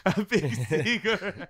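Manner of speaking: slow words broken by laughter; laughing repeat. Real, not acted. Laughing as he repeats the friend's lyric quote. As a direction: laugh through the lyric, slowly.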